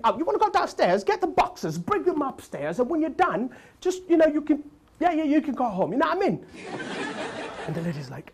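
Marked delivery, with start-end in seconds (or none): 0.0-6.4 cockney Jamaican. Retold or imitated. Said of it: "young Jamaican dude who's very cockney".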